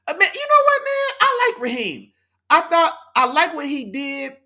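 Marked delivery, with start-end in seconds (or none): 0.1-1.6 excited high exclaim. Real, not acted. Host reacts to chat's coach criticism.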